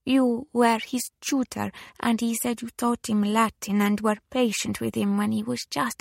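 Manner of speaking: put-on high, hushed Italian-accented lady; Italian widow, moved. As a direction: recall it tenderly in a high Italian voice. Widow remembers her son's tutor.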